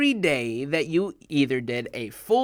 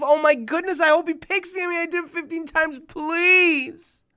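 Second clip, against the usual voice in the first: higher, excited begging thought; hopeful pleading; 11 semitones higher, 6 dB louder than usual. Retold or imitated. Young boy begging in his head to win, higher. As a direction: pray to be picked. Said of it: "I was thinking to myself".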